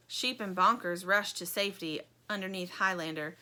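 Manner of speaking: usual speaking voice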